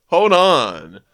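acted urgent shout; urgent call. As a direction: call out before takeoff. Surfer calls out during escape.

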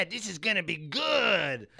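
loud, high, relishing cry; gleeful lewd dwarf. Acted. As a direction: crow it with lusty glee. Naked dwarf gloating on bed.

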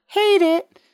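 sudden very high, loud burst; annoyed outburst. Real, not acted. Trucker griping about lane blockers, high exasperated burst. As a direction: spit it out in disgust.

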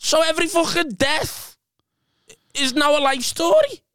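high-pitched comic complaint; high, protesting punchline delivery; exasperated comic rant. Real, not acted. Comic rant ending the story.

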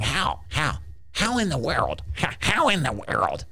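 high, squeezed cartoon devil; put-on flustered devil voice; baffled devil. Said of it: "He's going".

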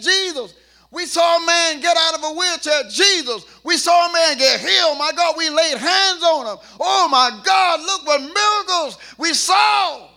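shouting, carried away by miracles; excited apostles. Acted. Apostles reporting miracles to Jesus, excited shouting. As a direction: burst out with joy at what you saw. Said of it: "apostles came back saying".

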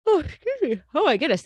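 words pushed out through a yawn. Real, not acted. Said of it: "he's yawning".

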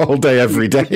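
laughing while mocking. Real, not acted. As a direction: jeer through laughter. Mocking the caller's boast.

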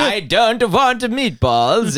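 very slow, high, hammed-up Dutch accent; a silly Dutch accent about meatballs; mock Dutch accent. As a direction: drag it out in a joking Dutch voice. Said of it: "A Dutch guy pretending to be a cowboy".

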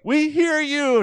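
high, loud call, laughing through it; hammed-up politician calling out for the camera; mock yell. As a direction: yell it like a staged speech at a fence. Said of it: "she's yelling".

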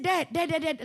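ordinary talk

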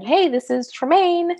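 high, slow and tentative; nervous phone greeting. Retold or imitated. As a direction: reintroduce yourself carefully on a first call. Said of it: "I said".

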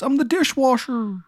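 high thin creepy voice; high appliance voice. Acted. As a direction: announce yourself in a high eerie voice. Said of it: "He goes".

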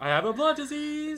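loud, high-pitched whiny wail; mock whining. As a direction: stretch the last word into a hammed-up whine. Acting out captive Shiloh's complaints.

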